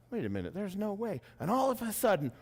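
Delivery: usual speaking voice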